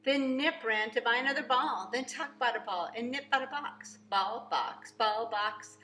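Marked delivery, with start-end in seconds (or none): none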